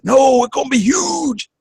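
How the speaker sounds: high, emphatic old-politician impression; Bernie Sanders. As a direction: declaim it like an excitable senator. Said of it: "He said".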